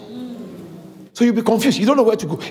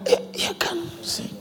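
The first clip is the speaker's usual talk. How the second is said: a sudden whisper, much softer; hushed; 7 semitones higher, 9 dB quieter than usual. Real, not acted. Pastor repeats the promise to the church, in a whisper. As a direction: breathe it like a secret. Sudden drop after shouting.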